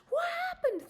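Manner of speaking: the husband shouting in panic; high panicked husband shouting; alarmed husband. Retold or imitated. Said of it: "he's like".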